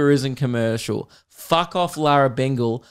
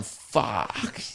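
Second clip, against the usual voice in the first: breathy low mock leer; hushed mock-lustful curse; lustful whisper; 5 semitones lower, 9 dB quieter than usual. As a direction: mutter it under your breath, ogling. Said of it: "looking at the chicks going".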